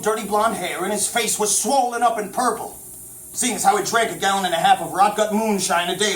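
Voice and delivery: usual speaking voice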